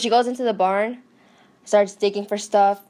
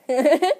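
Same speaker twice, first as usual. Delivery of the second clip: higher, stuttering giggle; laughing for no reason; 6 semitones higher, 4 dB louder than usual. Retold or imitated. Lizzie eating her toast, laughing for no reason. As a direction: giggle madly to yourself. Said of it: "laughing for no apparent reason".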